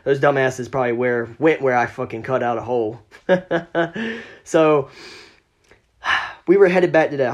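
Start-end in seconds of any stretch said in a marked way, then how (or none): 3.3-4.2 high, light chuckle; amused run of laughter. Real, not acted. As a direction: chuckle at your own story. Laughs at the trespassers.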